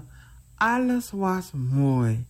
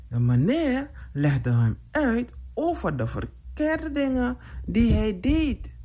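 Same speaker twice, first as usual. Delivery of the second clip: usual speaking voice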